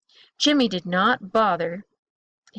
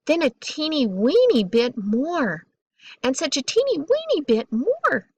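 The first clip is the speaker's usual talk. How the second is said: "weeny" stretched and swooping up high; playful storyteller emphasis, "weeny" pitched way up; stress on weeny; 4 semitones higher than usual. A narrator's reading. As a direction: lean on "weeny" with a high squeezed peak. Narrator plays up tiny door movements.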